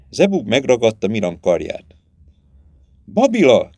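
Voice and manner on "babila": high, louder shout of a name; crying out. A narrator's reading. Herdsman shouts for the missing girl, crying out. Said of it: "kiáltotta".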